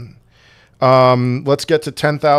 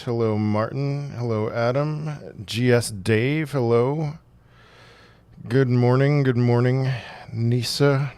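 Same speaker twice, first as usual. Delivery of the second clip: feeling sick; 7 dB quieter than usual. Real, not acted. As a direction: speak quietly, under the weather. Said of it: "I'm a little under the weather".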